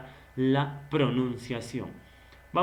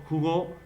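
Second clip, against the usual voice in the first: jugó rising onto the final GÓ; stress on last syllable; 3 semitones higher than usual. Shown as a demonstration. Teacher naming the second word, jugó stressed on GÓ. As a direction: land on GÓ in jugó. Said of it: "Y el segundo".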